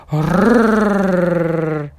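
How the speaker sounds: loud dog growl. A narrator's reading. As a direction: growl like a big shaggy dog. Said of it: "зарычал пёс".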